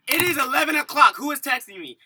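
high, loud exasperation; indignant outburst. Retold or imitated. Woken by a late-night text, loudly indignant. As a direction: demand it in comic disbelief.

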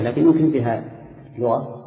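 ordinary talk

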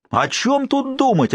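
high, slow, indignant outburst; exasperated, high-pitched retort. Acted. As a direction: raise your voice at the old man again. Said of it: "опять повысил голос Борис".

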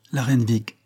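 plain reading voice

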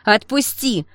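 loud, agitated outburst. A narrator's reading. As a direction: raise your voice to break free. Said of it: "повысила голос Теша".

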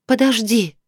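high-pitched female character voice; woman's plea. A narrator's reading. Woman asks the advancing man to wait, high pleading voice.

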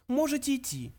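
high, offhand mouse voice; careless. A narrator's reading. Mouse lets the old man go, careless. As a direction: dismiss him lightly in a high voice. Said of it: "небрежно произнёс Бенджи".

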